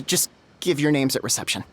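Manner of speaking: usual speaking voice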